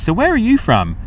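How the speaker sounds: imitating a curious local, pitched high; a nosy stranger's high question, lightly mocked; mock-polite Brit asking where he's from. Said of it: "all I get all the time".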